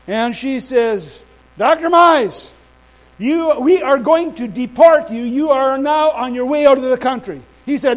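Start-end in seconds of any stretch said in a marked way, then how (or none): 1.6-7.4 loud, high-pitched imitation of an irate minister; angry official. Immigration minister confronting the preacher, angry. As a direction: lay down the deportation forcefully. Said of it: "she was very angry".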